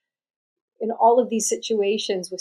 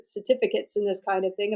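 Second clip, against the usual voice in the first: ordinary delivery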